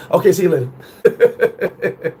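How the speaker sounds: slow sign-off breaking into laughter; laughing dismissal. Retold or imitated. Dumped boyfriend waving off the breakup, laughing dismissal. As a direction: drop the act and laugh it off. Drops fake begging for a laugh.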